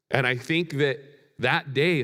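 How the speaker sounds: normal talking voice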